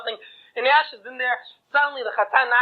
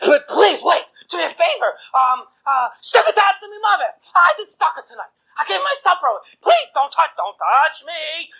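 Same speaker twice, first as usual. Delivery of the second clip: panicked begging; 6 semitones higher than usual. Acted. Groom keeps begging the angel.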